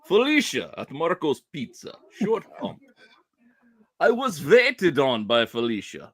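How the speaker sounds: put-on Russian accent. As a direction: read it like a hammy Russian. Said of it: "Felicia in your Russian accent".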